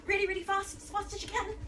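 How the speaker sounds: put-on energetic coach egging kids on; excited urging. Shown as a demonstration. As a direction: cheer them to go really fast. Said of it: "coaching faster jogging".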